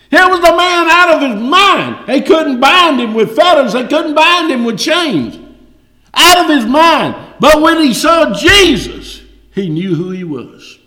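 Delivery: high, loud preaching cry; preaching at full force. Acted. Preacher crying out the madman's story to the church, loud. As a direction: drive each line home from the pulpit.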